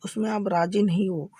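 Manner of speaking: normal talking voice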